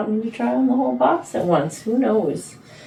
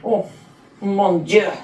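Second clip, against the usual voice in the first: put-on French accent. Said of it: "See, I'm French there".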